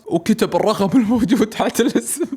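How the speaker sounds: breaks into a giggle mid-line; laughing. A narrator's reading. Narrator telling listeners, laughing through the line.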